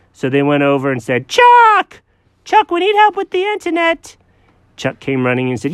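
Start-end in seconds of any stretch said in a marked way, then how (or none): 1.3-4.2 high, loud call across the room. Acted. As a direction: shout for Chuck to come. Calling out to Chuck.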